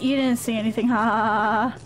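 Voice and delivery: usual speaking voice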